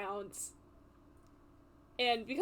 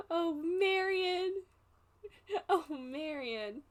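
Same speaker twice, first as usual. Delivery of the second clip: slow, drawn-out sighing lament; sighing dismay; 6 semitones higher, slower than usual. Acted. Adventurer sighs over Marion, slow dismay. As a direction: draw the name out slowly.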